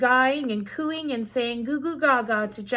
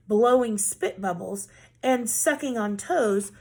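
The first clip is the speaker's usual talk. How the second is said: usual speaking voice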